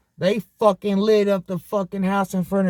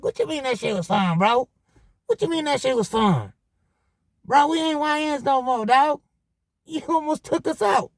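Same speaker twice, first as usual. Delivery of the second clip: higher, exasperated "what you mean that shit was fun"; incredulous rant; 7 semitones higher than usual. Retold or imitated. Speaker scolding the homeboy who nearly got him shot, exasperated. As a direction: scold him like he's lost his mind.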